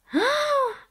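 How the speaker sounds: sharp high breathy intake; scandalized gasp. Acted. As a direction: gasp in mock shock. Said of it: "Haruka spalancò la bocca".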